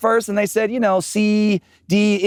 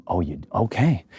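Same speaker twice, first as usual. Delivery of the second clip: low principal voice; 13 semitones lower, 5 dB quieter than usual. Retold or imitated. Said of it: "And he goes".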